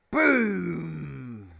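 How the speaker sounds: loud, high, drawn-out boom; booming crash. A narrator's reading. Narrator sounding the knock at the hut door, booming.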